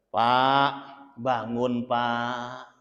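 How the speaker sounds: soft, low coaxing voice; gentle wake-up call. Retold or imitated. Wife gently waking husband for dawn prayer, soft. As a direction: wake him tenderly. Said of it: "Ajak dia dengan penuh kelembutan".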